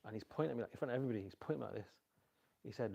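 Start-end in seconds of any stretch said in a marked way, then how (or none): none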